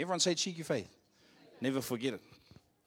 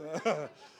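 short burst of laughter; real laugh; 3 semitones higher than usual. Real, not acted. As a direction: laugh at your own doubt. Laughs at own doubt.

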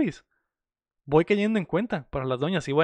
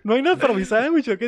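higher, louder mock whine for a sandwich; mock whiny demand; 6 semitones higher, 3 dB louder than usual. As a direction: play the spoiled man wanting his sandwich. Voicing Pedrito demanding sandwich.

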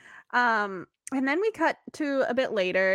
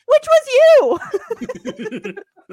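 Josh impression; 13 semitones higher, 12 dB louder, faster than usual. Retelling Josh's comeback line.

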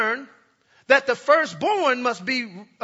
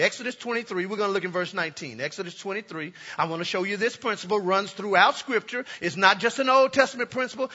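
ordinary talk, nothing marked

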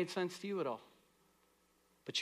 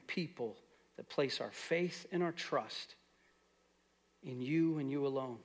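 softer, lower prayer voice; closing prayer; 4 semitones lower, 3 dB quieter than usual. Acted. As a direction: pray quietly for the church.

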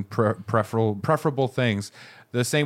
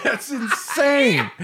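high, slow laughing exclamation; laughing disbelief; 11 semitones higher, slower than usual. Real, not acted. Co-host reacting to the haircut story, laughing disbelief. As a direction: draw it out through laughter.

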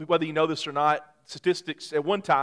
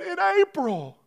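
tearful wife; slower than usual. Retold or imitated. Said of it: "She said".

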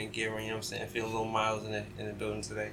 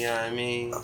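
ordinary talk, nothing marked

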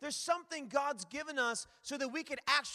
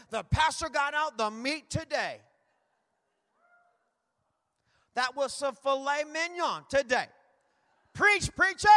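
hammed-up churchgoer praise; 7 dB louder than usual. Churchgoers hailing the pastor's meaty sermon, hammed-up loud praise. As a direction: imitate a fired-up congregant cheering the preacher.

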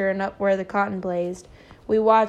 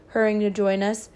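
ordinary delivery, nothing marked

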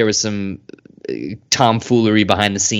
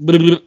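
high, louder flustered blubbering; stunned blubbering; 6 semitones higher, 5 dB louder than usual. Retold or imitated. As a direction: sputter in disbelief. Stunned reaction to line.

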